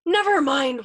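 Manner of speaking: loud, high, despairing cry; wailing. A narrator's reading. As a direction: wail it, giving up on him. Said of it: "wailed Yossarian".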